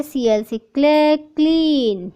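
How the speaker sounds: usual speaking voice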